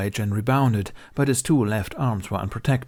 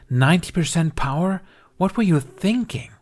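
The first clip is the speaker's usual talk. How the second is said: much higher, louder incredulous scold; exasperated scolding; 9 semitones higher, 5 dB louder than usual. A narrator's reading. Healer scolding Ben over the duel, incredulous. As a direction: demand an answer in disbelief.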